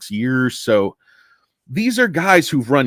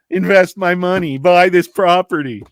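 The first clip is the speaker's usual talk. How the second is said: loud, higher-pitched demanding boss; demanding client; 6 semitones higher, 5 dB louder than usual. Acted. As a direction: order it like a pushy big client.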